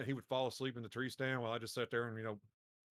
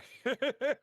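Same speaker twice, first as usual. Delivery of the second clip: granddad cackle; 12 semitones higher, 7 dB louder than usual. Retold or imitated. Granddad proud of the shot.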